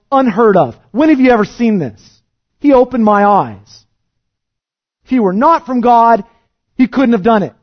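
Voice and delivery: loud, high, impassioned preaching; preacher voicing the healed man's bold defence at full force. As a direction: drive the healed man's case home.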